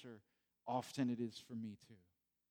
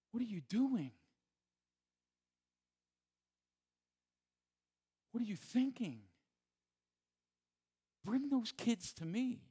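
much higher, urgent rebuke; indignant Jesus; 9 semitones higher than usual. Acted. Pastor voicing Jesus scolding disciples, indignant and higher. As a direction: scold the disciples, then call the kids.

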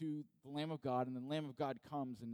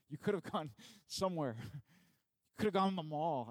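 laughing through the words, livelier and louder; amused laughing; 3 semitones higher, 5 dB louder than usual. Real, not acted. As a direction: let a laugh break into the joke. Jokes about other places to go.

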